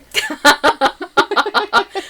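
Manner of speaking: high giggling laugh; burst of laughter. Real, not acted. Cohost amused by talk of bollocks, high laughter. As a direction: laugh helplessly at a silly rude word.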